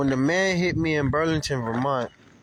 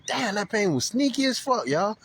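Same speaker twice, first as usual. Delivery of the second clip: higher, half-laughing pained surprise; sudden pain; 7 semitones higher than usual. Retold or imitated. Cyclist feeling the crash injury late, higher and amazed. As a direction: react to a sneaky pain catching up. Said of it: "I was like".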